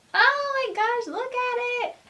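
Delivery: high delighted squeal; excited. Real, not acted. Admiring the bag.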